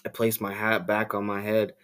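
usual speaking voice

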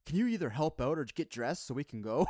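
loud, exasperated demand to help or dress; wife in the change room snapping at her husband, exasperated; impatient wife. Retold or imitated. Wife impatiently scolding him.

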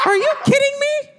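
loud, high Jamaican mom yelling; Jamaican accent. Retold or imitated. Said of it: "in her loud Jamaican accent just goes".

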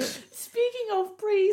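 high, slow sing-song tease; teasing. Acted. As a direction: drag it out playfully high. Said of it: "teasing topic change".

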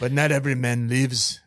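loud, laughing Scottish line; a laughing try at a Scottish hero; barely Scottish, laughing through it; Scottish accent. Retold or imitated. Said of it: "That's my Scottish accent".

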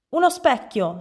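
high-pitched, urgent outcry; clamouring. A narrator's reading. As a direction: demand it in a fluster. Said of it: "strepitò il signor Dal Verme". (esclamazione strepitante)

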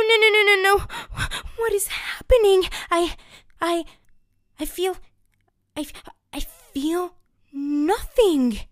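high, loud, frantic wail; panicked toothbrush. Acted. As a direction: fall apart in desperate panic. Chupi despairing, soul leaving.

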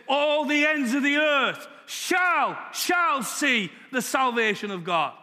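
high, slow ringing proclamation; proclaiming shout. Real, not acted. Preacher proclaiming the verse to his church, slow ringing shout. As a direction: proclaim it like a trumpet call.

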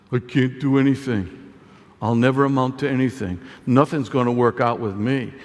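low, dejected mock moan; defeated self-talk. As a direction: sink into hopeless self-pity.

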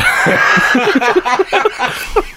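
high, rising burst of laughter. Real, not acted. Laughing at the story.

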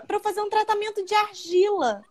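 "tratamento de argila" pitched high in the client's innocent voice; imitating the client. ("tratamento de argila" dito agudo e ingênuo, imitando a cliente)

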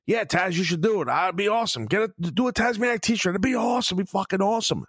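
high-pitched mock-enthusiastic fan; gushing fan. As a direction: egg him on like an overeager supporter. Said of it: "Be one of these guys".

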